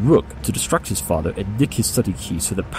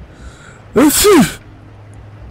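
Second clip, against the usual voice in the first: weary sigh; 10 dB louder than usual. Acted. Sherlock sighs, wanting to leave.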